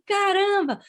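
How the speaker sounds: high surprised exclamation. Real, not acted. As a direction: react with genuine astonishment. Reacting to surprising news.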